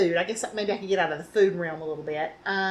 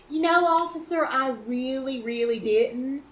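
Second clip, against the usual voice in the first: put-on Southern accent. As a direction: play the sorry Southern driver with a mild Southern accent. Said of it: "I pulled off the biggest Southern accent".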